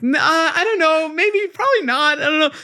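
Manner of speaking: high, strained voice; squeaky hedging. Retold or imitated. Hedging to the host over a pricey bottle, squeaky and strained. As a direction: squeak out a nervous no. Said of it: "I'm like".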